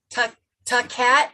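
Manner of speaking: "ta-, ta-, cat" with a T swapped onto the first word; flipped first letters. Shown as a demonstration. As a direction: say "ta-, ta-, cat", stumbling on a T in place of "the". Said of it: "I switch the first letters".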